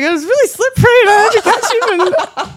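high and loud, laughing through it; breathless with relief and a laugh; excited husband. Retold or imitated. Imitating her husband after the catch, high, loud, laughing. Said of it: "And he was like".

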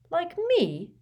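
Harry can't believe it; high incredulous question. A narrator's reading. Harry surprised at comparison.

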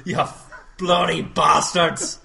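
loud, slow, high Scottish battle roar; Scottish accent. Retold or imitated. Finishing the Gurney bit with a slow, loud Scottish accent. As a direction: drag it out like a Highland war cry in a Scottish accent.

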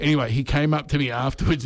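normal talking voice

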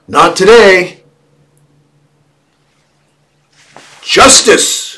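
high, loud slogan cry; mock shout. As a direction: call it out like a mock battle cry.

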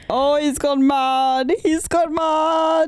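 high, drawn-out mock cry of alarm; hammed-up, slow, high-pitched exclamation; gossiping guards. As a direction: imitate guards wailing slowly in a put-on voice. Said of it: "Yes, they're like".

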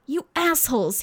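a lightly voiced hiss at the two of them; hissed insult. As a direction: spit it out, mildly. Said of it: "he hissed".

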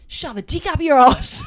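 put-on high, loud Aussie-accent shout; exaggerated Australian accent. Host imitates a friend teaching Americans the accent, loud broad Australian. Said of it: "teaching them the Australian accent".

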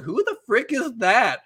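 high, slow mock disbelief; exaggerated high-pitched puzzlement; acted incredulity. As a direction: drag out a baffled question in imitation. Everyone baffled, acted.

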